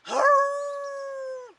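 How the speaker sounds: loud, high, drawn-out howl; hound dog howl. A narrator's reading. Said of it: "hollered that old hound dog".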